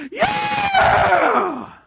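very high, drawn-out scream of joy; cheering coach. Retold or imitated. Coach celebrating the stopped first down, screamed cheer. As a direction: shriek a wild, long cheer.

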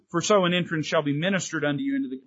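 fast, skipping the key word; wrong version, rushed. Shown as a demonstration. Preacher showing the verse without its key word, rushed. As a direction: rattle it off quickly and leave out abundantly. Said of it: "But he doesn't say".

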